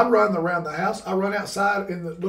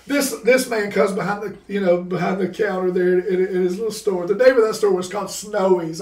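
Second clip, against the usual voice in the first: normal talking voice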